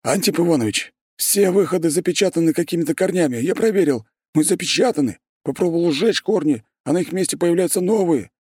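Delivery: out of breath, high and urgent; urgent panting report. A narrator's reading. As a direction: gasp out the report after running. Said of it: "задыхаясь, выпалил прибежавший боец".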